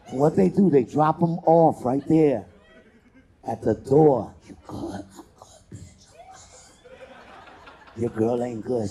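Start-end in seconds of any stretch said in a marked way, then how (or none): 4.5-8.0 put-on breathy drunk whisper, laughing through it. Drunk friend dropped at the door, whispered and giggling. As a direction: whisper it like you're wasted at the door.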